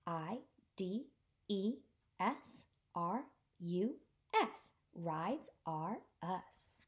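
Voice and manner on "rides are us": plain reading voice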